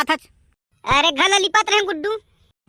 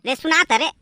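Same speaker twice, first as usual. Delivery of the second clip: ordinary talk, nothing marked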